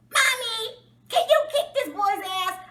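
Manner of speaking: high-pitched mock kid pleading; whining child. Imagined child asking mom for backup, mock whine.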